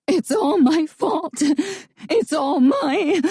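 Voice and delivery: anguished. A narrator's reading. Girl breaking down in guilt.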